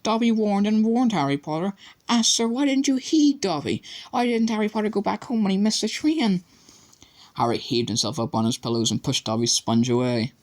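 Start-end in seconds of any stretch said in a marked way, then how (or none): 0.0-6.4 high, squeaky pleading elf; distressed house elf. A narrator's reading. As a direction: plead with Harry anxiously. Dobby voice held.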